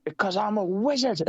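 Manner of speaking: high mock Jericho voice; high-pitched mocking quote; Jericho impression. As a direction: squeak out the catchphrase. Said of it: "It is".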